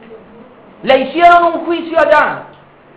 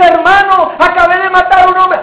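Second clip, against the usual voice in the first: desperate confession; 6 semitones higher than usual. Acted. Brother confessing a killing to his brother, desperate. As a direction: blurt it out in terror. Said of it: "así desesperado".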